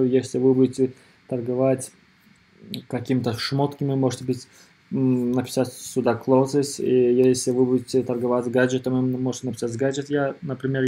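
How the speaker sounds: normal talking voice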